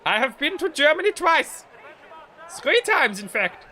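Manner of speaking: loud exaggerated German accent. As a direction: boast in a hammed-up German accent. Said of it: "accurate representation of a German accent".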